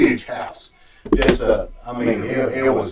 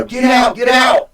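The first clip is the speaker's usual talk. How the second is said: much higher, louder shout of "Get out, get out!"; a stronger, higher repeat of the command; retold shout, stronger; 12 semitones higher, 5 dB louder than usual. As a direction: yell it urgently, driving him from the room. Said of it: "Like, you know".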